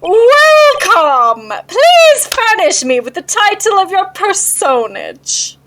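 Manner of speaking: high, drawn-out, booming grand welcome; pompous CEO. Acted. Voices pompous CEO in game.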